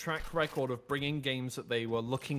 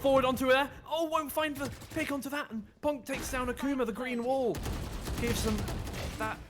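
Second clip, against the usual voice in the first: high, urgent esports commentary; tense running shoutcast; excited play-by-play; 7 semitones higher than usual. Real, not acted. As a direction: call the action live, pitch riding up with each kill. Live play-by-play.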